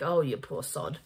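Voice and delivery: low, soft pitying mutter; a soft, low "oh, you poor sod"; pitying aside. Retold or imitated. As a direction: sigh out sympathy for the poor character. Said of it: "I was like".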